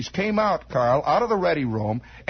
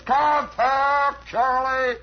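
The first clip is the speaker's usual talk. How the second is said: hollered command; 7 semitones higher, much slower than usual. Retold or imitated. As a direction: bark it up from the cockpit. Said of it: "this guy hollers".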